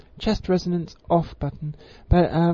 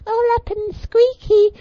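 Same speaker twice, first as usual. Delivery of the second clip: strained, squeaky falsetto, much higher and louder; overdone high squeak to show too much pitch; 6 dB louder than usual. Shown as a demonstration. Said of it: "If you go".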